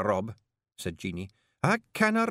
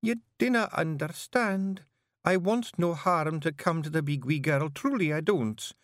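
tearful plea; 11 semitones higher than usual. Acted. Jeanie begs Rob not to fight the monster, tearfully. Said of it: "beginning to cry".

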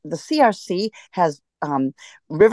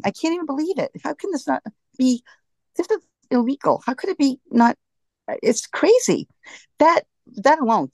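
outraged disbelief, pitched much higher; frustrated; 9 semitones higher than usual. Real, not acted. Guest on radio show, upset the river isn't protected, frustrated and high-pitched. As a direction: let the exasperation spill out. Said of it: "I'm so frustrated".

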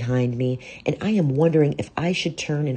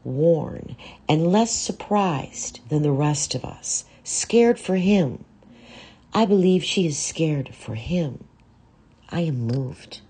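ordinary delivery, nothing marked

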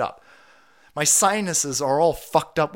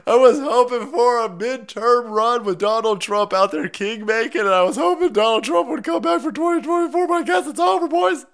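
high-pitched fake wail, climbing higher; mock despair; 8 semitones higher, 4 dB louder than usual. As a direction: cry out hammed-up heartbreak, higher and higher. Pretends despair at Trump's end.

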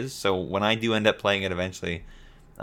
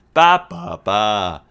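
newscaster babble; 8 dB louder, much slower than usual. Shown as a demonstration. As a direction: babble with a trailing anchor tune. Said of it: "you have to end all of your phrases like".

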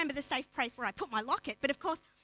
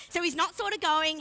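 ordinary talk, nothing marked

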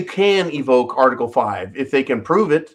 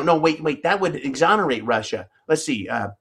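ordinary talk

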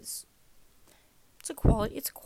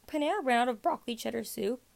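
voice crack; 5 dB louder than usual. Real, not acted. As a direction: voice cracking mid-sentence. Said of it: "That was a solid voice crack".

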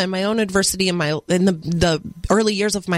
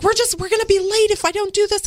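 put-on higher, worried little boy; flustered child; 11 semitones higher than usual. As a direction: voice the panicked kid rushing. Flustered son voiced in story.

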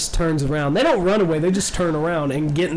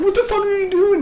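imitated high-pitched panicked shout; panicked yell; 4 dB louder, faster than usual. Passenger to driver speeding from cops, mock panicked yell. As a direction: shriek it at a reckless driver, hammed-up.

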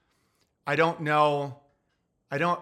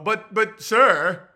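mock timid clerk; 5 semitones higher, 6 dB louder, much slower than usual. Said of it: "And they go".

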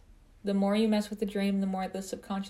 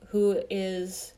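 ordinary talk, nothing marked

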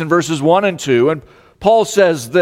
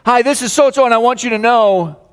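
higher-pitched, excited caller voice; good-news excitement; 8 semitones higher than usual. Acted. Caller phoning a loved one with great news, excited. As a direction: burst onto the line with great news. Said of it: "someone who calls with great news".

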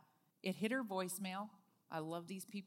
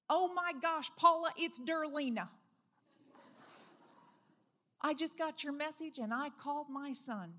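bright, loud, rushing with joy; excited friend; 7 semitones higher, 6 dB louder than usual. Retold or imitated. Friend calling back from Georgia, thrilled. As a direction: gush like a thrilled friend on the phone.

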